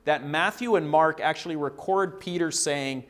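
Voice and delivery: ordinary talk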